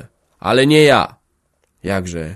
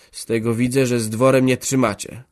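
ordinary delivery, nothing marked

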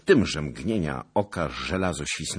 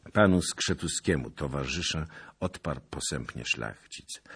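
ordinary delivery, nothing marked

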